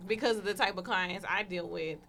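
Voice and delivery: usual speaking voice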